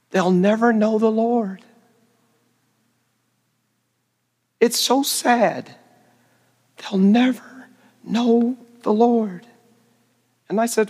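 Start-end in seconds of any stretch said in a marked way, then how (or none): 0.1-9.5 slow, mournful, mildly imitating a crying woman; a missionary wife weeping beside the bonfire, mock mournful; retold tearful lament. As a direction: drag it out like a grieving friend, understated. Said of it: "deal with her crying".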